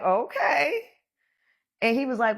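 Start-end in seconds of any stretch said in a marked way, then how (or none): 0.0-0.8 laughing okay. Retold or imitated.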